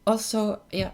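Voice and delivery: normal talking voice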